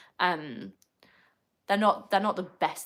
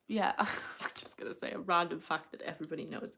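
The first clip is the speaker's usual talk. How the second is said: hushed aside; 2 semitones lower, 4 dB quieter than usual. Real, not acted. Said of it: "I'll keep myself quiet".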